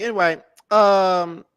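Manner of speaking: usual speaking voice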